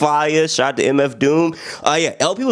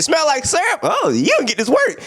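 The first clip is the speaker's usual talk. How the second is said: excited shout; 13 semitones higher than usual. Retold or imitated. Rapper hyping the syrup line, excited shout. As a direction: yell it like the best news ever. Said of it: "I love the way he said it".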